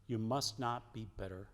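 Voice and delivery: usual speaking voice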